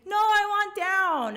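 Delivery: high, slow whiny plea; pleading. Retold or imitated. Sixty feet up, begging her sister, high slow whine. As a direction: beg to come down. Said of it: "I was like".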